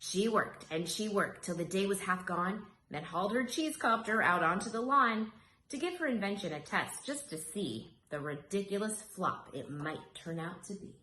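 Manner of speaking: ordinary delivery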